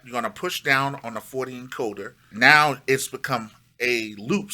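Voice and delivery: ordinary delivery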